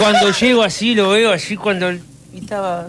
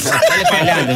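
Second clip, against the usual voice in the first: imitated cheer. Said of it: "me dice".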